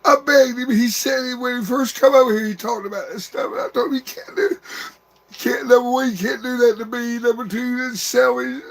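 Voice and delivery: high, breaking voice fighting through tears; crying out grief for a lost brother; real sobbing. Real, not acted. Brother speaking to his dead little brother, sobbing.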